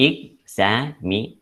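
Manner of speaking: examination slowly split up, leading to the stressed NA; examination in spaced teaching beats; syllable by syllable. Shown as a demonstration. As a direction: sound out examination in separate slow syllables. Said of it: "നെയ് എന്നുള്ളതിലാണ് stress വരുന്നത്".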